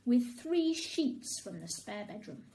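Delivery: plain reading voice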